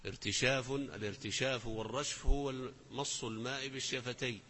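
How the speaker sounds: normal talking voice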